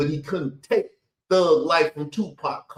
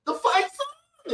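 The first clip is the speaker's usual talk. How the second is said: much higher, slow, sing-song mock of "The fight's on"; mocking his inflection; slower than usual. Mocking the clip narrator's inflection for his audience, high slow sing-song. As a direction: stretch it out high and theatrical, mocking. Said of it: "can you hear the inflection in his voice".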